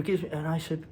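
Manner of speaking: usual speaking voice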